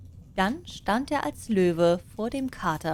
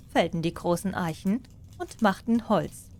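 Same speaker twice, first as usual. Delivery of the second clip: ordinary delivery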